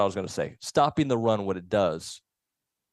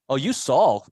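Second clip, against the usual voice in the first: ordinary talk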